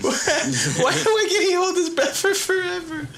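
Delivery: squealing laugh high up. Real, not acted. Storyteller losing it at his own joke. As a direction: crack up through the question. Narrator cracking up.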